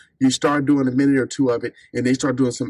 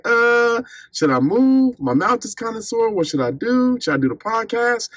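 higher, whiny musing to himself; a sore-mouthed man fretting on the couch, pitched up; whiny sore self; 9 semitones higher than usual. Retold or imitated. Fretting on his couch after the dentist, higher whiny voice. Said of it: "kinda like".

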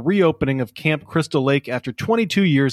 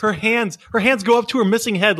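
usual speaking voice

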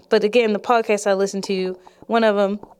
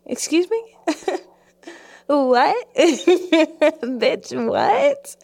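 much higher, hammed-up indignant "what?"; exaggerated outraged disbelief; 7 semitones higher, much slower than usual. To her date accusing her of lying, mock outraged disbelief. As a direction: overplay the shocked comeback, pitch flying up. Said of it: "I didn't say it like that".

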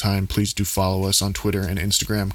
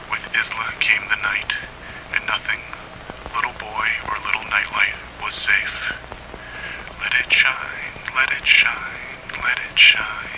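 dramatic audiobook narration; storyteller voice; story narration; 9 dB louder than usual. A narrator's reading. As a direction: read it like a tense horror tale. Reads horror story aloud.

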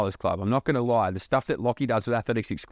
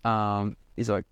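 usual speaking voice

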